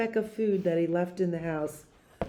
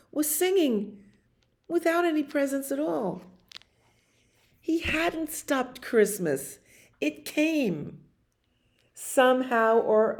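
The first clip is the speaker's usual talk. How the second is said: amazed; 8 semitones higher than usual. A narrator's reading. Wonder at the Whos singing.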